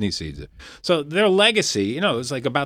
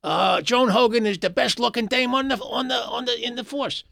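Mickey Freeman impression; 9 semitones higher than usual. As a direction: mimic the old sitcom character's delivery. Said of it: "He used to talk like this".

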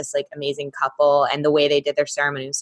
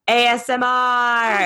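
drawn-out letters, slow; announcing; 5 semitones higher, slower than usual. Real, not acted. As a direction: spell it out slowly like a reveal. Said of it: "good day to do some".

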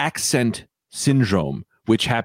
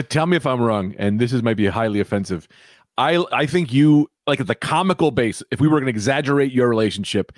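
ordinary delivery

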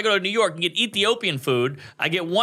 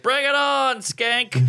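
high-pitched cocky comeback; hammed-up dare; mock taunt; 10 semitones higher, slower than usual. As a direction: throw down a retold challenge. Retold taunt back at bride.

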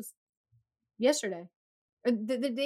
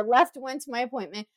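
normal talking voice

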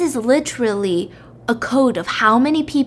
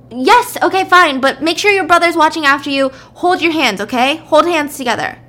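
louder, higher, harried giving-in; exasperated mom; 5 semitones higher, 5 dB louder than usual. Retold or imitated. Mom letting pestering kids go play, exasperated. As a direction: give in impatiently, then issue orders.

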